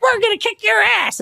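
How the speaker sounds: big-talking boast pitched high; cocky trash talk. Retold or imitated. Offense bragging to opponents, a high cocky boast. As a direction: crow it like a swaggering little brother. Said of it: "the Texas offense is coming in and it's like".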